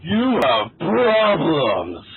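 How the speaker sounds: slow, drawn-out blurt; blurted slowly. Acted. Fortune teller slowly blurts his problems. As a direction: drag the words out then blurt. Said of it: "slow down before blurting out".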